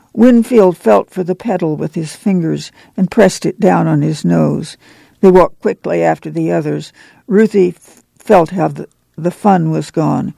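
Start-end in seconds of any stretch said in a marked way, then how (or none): none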